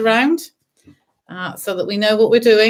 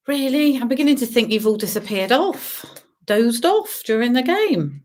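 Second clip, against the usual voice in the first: ordinary talk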